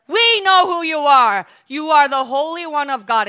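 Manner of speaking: loud, high proclamation; demonic outcry. Acted. Demons announcing Jesus before the people, crying out. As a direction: shout it out, stretched and high.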